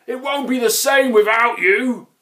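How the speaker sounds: high, loud, pleading shout; calling out. A narrator's reading. Grunt begs his friend to come back, calling loudly. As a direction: plead at the top of your voice. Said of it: "he called".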